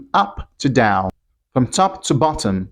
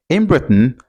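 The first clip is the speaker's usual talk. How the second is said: normal talking voice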